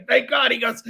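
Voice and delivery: ordinary talk, nothing marked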